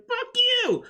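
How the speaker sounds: very high, loud mock scream; defiant yell. Goku yelling back at Beerus, very high defiant yell.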